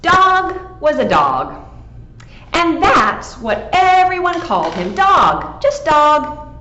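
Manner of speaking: bright, lively read-aloud voice; telling the story to children with gusto; storybook narration. A narrator's reading. Librarian reading to kids, lively storybook voice. Reads picture book aloud.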